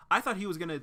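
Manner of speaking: normal talking voice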